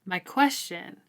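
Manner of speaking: ordinary talk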